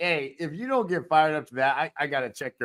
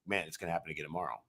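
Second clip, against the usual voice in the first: gloomy mutter; 11 semitones lower, 13 dB quieter, much faster than usual. Retold or imitated. Voicing pessimist.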